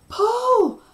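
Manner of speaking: very high, loud scream; frantic high shout on one word; almost hysterical cry. A narrator's reading. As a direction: cry out in near panic. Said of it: "I cried, almost hysterical".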